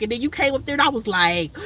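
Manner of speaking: usual speaking voice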